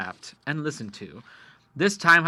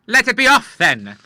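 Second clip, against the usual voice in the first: higher and louder, brushing him off; dismissive; 10 semitones higher, 12 dB louder than usual. A narrator's reading. The Admiral refusing the agent's deal, loud and dismissive. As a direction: let the offer go with a wave.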